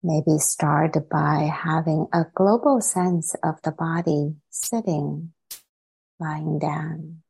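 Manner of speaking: unhurried meditation guidance, long silences between phrases; guided meditation. Acted. Teacher settling the group into meditation, calm and spacious. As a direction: guide them calmly, softening as phrases settle.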